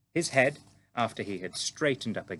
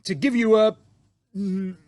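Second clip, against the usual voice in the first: big booming giant voice, higher and louder; friendly giant; 12 semitones higher, 11 dB louder than usual. A narrator's reading. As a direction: boom it out like an eager, bumbling giant. BFG voiced in story.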